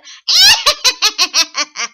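high, loud, nasty witch cackle; stanky wicked witch laugh; nastier witch cackle. Shown as a demonstration. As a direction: cackle nastily with nostrils flaring. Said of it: "Can you get nastier with it?".

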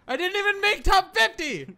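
loud, high gamer rage; high, loud losing-player yell; frustrated shout. Real, not acted. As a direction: shriek the complaint in outrage. Complains about losing the game.

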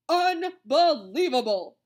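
very loud, slow, high exclamation; outraged scolding. A narrator's reading. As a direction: thunder it out slowly in outrage. Monitor scolds the lunchroom.

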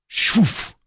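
high, breathy whoosh of a flying knife; whooshing dagger sound. A narrator's reading. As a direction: make the dagger whizz past. Narrator imitates flying dagger.

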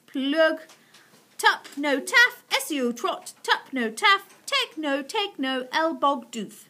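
high, chant-like nonsense recital; reading out gibberish. A narrator's reading. Missus Silver reading the tortoise spell, high incantation. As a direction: read strange words out in short groups. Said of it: "This is what she read".

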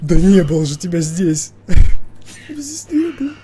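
panicked high yelling at the screen; frightened outburst with a nervous laugh; scared horror game streamer. Real, not acted. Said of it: "напуган просто до усрачки". (испуганный крик)

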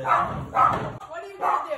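loud, drawn-out taunt; yelled taunt, stretched out; taunting shout. Real, not acted. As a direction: shout it as a challenge. Taunting sibling in prank fight.

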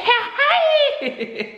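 very high-pitched giddy laugh; delighted squealing laugh. Real, not acted. Vlogger overjoyed by the surprise TV, squealing laugh.

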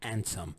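handsome said as andsome; Cockney andsome; dropped H. Shown as a demonstration. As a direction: drop the H in handsome. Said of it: "يقولون handsome".

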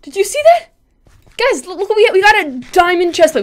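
high, loud rush of excitement; excited. Real, not acted. Gamer showing viewers a lucky find, excited. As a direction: burst out to the viewers.

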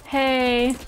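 ordinary talk

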